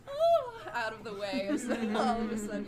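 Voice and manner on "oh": very high, loud yelp; startled old lady. Acted. Old lady yelps as the cart races past, high and loud. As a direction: squeak like a frail old lady jumping aside. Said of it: "a little old lady goes".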